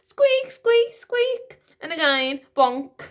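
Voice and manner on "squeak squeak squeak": high squeaking pram wheel; shrill high wheel squeaks; squeaky wheel noise. A narrator's reading.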